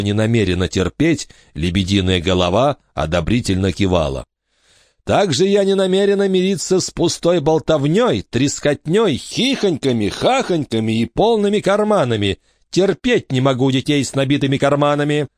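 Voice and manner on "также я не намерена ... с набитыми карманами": prim, high, disapproving matron voice; a stern lady warning the orphans of her rules; stern strict lady. A narrator's reading. Stern lady lists more dislikes.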